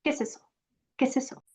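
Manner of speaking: "¿Qué es eso?" fused, soft and fast; quiet native question; natural blended speech. Shown as a demonstration. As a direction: run it together like one word. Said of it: "So it kind of sounds like queso".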